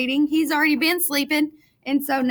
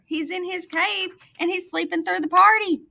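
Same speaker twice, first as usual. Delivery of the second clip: usual speaking voice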